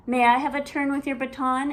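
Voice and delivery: high, loud and fast; little sister begging for a turn; quick eager ask. Acted. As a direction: rush the request out. Ivy asks eagerly.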